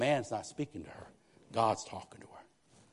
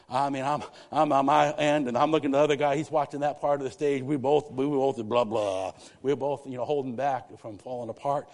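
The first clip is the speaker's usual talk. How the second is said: louder preacher rising into emotion; preaching; 3 semitones higher, 9 dB louder than usual. Real, not acted. Preacher telling the church how he held back tears. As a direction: push it out like a pulpit story.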